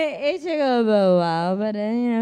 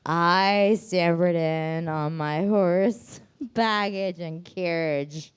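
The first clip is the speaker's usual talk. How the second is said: slow drawl; much slower than usual. Pretend. Jokey drawn-out storytelling.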